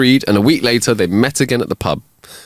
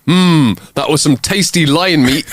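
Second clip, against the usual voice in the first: higher, savouring mock relish; blurted bluff; 6 semitones higher than usual. Dog bluffing the charging lion, higher with exaggerated relish. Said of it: "he blurts out".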